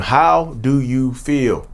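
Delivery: very slow, pointed asking; slow, deliberate question. Real, not acted. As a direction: draw each word out with weight. Returns to key question slowly.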